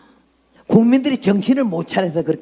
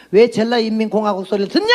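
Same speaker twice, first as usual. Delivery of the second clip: challenging question in usual pitch, rising at the end; retold challenge; faster than usual. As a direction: pose a pointed question, lift the last word. Retelling her challenge to Jeolla people.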